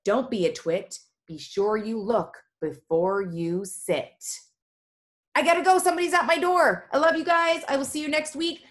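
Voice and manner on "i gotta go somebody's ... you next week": high-pitched, excited sign-off; hurried cheerful goodbye. Real, not acted. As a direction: call out a rushed, affectionate farewell. Rushed cheerful goodbye to students.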